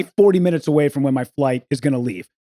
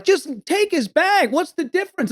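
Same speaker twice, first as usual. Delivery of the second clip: protesting bystanders; 11 semitones higher, 3 dB quieter than usual. Retold or imitated. Bystanders urging airline staff to relent, imitated protest. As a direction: chime in like exasperated onlookers.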